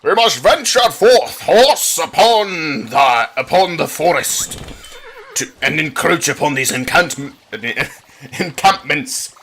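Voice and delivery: high, slow, grand royal voice; booming regal proclamation, pitched high; king voice. Acted. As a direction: play a pompous medieval king. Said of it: "Oh yes, my voice".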